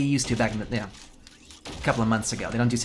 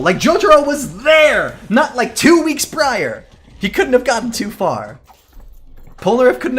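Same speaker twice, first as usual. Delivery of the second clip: high, loud and slowed, near yelling; outraged shout; 10 semitones higher, 8 dB louder, slower than usual. Real, not acted. Streamer yelling at chat that Jotaro was right there, outraged. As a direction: shout the obvious at the screen. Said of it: "This is my own personal rant".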